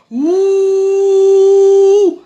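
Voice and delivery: loud drawn-out booing ouh; mock booing. Leading the girls in booing irresponsible men, loud ouh. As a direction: boo them like a jeering crowd.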